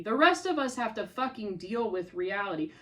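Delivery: normal talking voice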